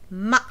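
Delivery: ma as a light, sudden syllable; tiny cut-off ma; short staccato ma. Shown as a demonstration. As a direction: clip ma off quickly and lightly. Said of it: "It is a little staccato".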